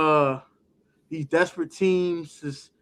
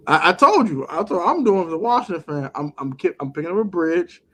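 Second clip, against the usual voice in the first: ordinary talk, nothing marked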